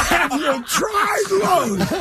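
high, hugely drawn-out words; incredulous mocking repeat. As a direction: stretch out the clerk's line in mocking disbelief. Incredulous repeat of clerk's punchline.

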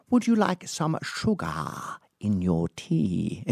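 slow, overly earnest host offering sugar; intense polite host. Retold or imitated. Host offering tea to a guest, slow and overly earnest. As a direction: lean into the politeness, drawn out. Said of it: "he'd be like".

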